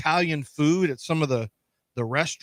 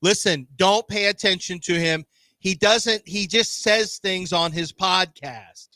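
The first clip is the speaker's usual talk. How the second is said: slow, raised, over-enunciated delivery; slower and louder; 4 semitones higher, 3 dB louder, slower than usual. Shown as a demonstration. American explaining football to Germans, slower and louder. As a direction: spell it out slowly and loudly. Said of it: "I'll talk slower and, like, louder".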